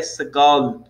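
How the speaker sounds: SEGOL ending in a true L, tongue up on the palate; drawn-out Segol, final L never turned into "w". Shown as a demonstration. Teacher showing students the final L, tongue-on-palate Segol. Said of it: "o L sempre vai terminar no céu da boca".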